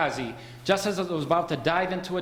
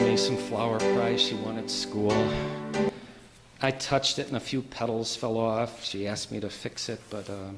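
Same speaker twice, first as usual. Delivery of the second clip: quiet and defeated; 8 semitones lower, 4 dB quieter than usual. Acted. George despairing at home.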